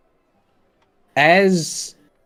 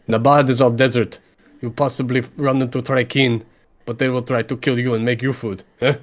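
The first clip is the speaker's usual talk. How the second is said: put-on incredulous rabbit-folk contractor with a Slavic accent; emphatic, broken English in a thick Slavic accent; Anton. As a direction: dismiss the question with Slavic-accented bluntness. Anton answers the player.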